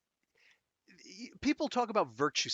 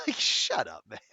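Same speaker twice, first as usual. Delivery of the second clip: laughing; 8 semitones higher, 5 dB louder than usual. Real, not acted.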